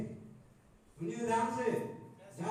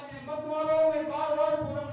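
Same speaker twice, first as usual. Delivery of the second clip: acted protest, higher and louder; raised, pained pleading; pleading stone; 5 semitones higher, 7 dB louder than usual. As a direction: beg insistently as the hurting stone. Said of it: "फिर आवाज".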